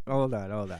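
normal talking voice

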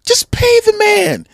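mock fan pleading loudly at the team; exasperated yell. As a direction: hammer home pay the man. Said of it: "I'm sitting there going".

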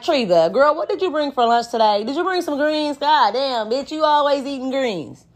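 casual talk with a coworker friend. Retold or imitated. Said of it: "I'll be like".

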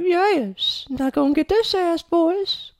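squeaky, very high gloating voice; mocking high taunt. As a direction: gloat in a silly falsetto. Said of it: "hop out the window like".

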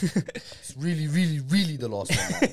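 slow, stretched "really, really"; drawn-out emphasis. Real, not acted. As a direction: drag out each "really" in playful insistence. Insisting jokingly after repeated last ones.

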